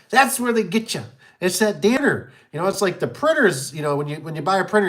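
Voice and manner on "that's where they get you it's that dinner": knowing realization. Retold or imitated. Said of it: "and I thought".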